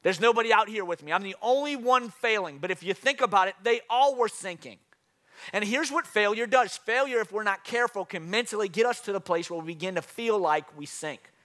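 high, loud preaching, driving each point home; rally the congregation like a fired-up pastor; preaching at full force. Acted. Pastor to congregation about failure, preaching high and loud.

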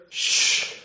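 long, forceful shush; loud shushing. Acted. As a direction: hush him firmly, acting God. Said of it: "God the Father had to come in and say to Peter".

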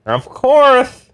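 high, loud Carr agreement. Acted. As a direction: say it eagerly in the Carr voice. Carr voice on the phone.

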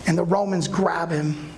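normal talking voice

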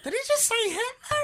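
very high-pitched outraged wife; mock shocked. Wife overhearing insult on speakerphone, high mock shock. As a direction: squeal it in disbelief.

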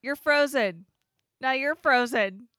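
hammed-up frustrated shouting; mock exasperated yelling. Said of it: "but it's like".

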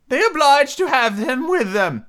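high, slow, drawn-out turtle voice; Mock Turtle figure. Mock Turtle explaining to Alice, high character voice. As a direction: pitch it way up as a storybook turtle.

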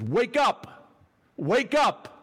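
high, loud wake-up call; urgent preacher's cry; rousing shout. Acted. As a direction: shout it to jolt sleepers awake. Preacher acting Paul's wake-up call.

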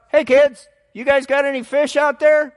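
high, loud hail across the water; a stranger on the beach hails the fishermen, high and loud; calling out. Retold or imitated. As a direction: call out to the boat from the beach. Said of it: "yells out".

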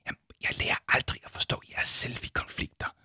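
resigned whisper; hushed, voiceless exasperation. Acted. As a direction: whisper it, giving up. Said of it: "hviskede Arthur".

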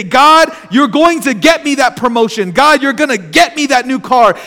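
demanding prayer. Retold or imitated. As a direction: tell God what he will do. Voicing name-it-claim-it prayers.

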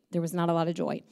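ordinary talk

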